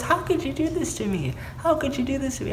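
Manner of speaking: high-pitched mock distraught protest; dramatized wail of betrayal in a retelling; retold upset outcry. Retold breakup quarrel, she storms out.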